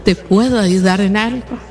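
normal talking voice

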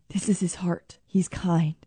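hushed, breathy whisper of tenderness; soft sermon whisper. Real, not acted. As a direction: drop to a whisper on his kindness.

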